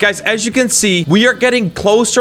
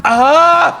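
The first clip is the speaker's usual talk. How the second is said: frustrated yelp; 8 semitones higher than usual. Real, not acted. Jumped off too late.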